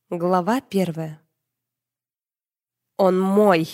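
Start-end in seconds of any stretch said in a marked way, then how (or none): 3.0-3.7 understated battle cry from an enraged demoness; a contained, warlike outburst; militant furious cry. A narrator's reading. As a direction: claim him fiercely, without a full shout. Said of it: "воинственно закричала взбешённая демоница".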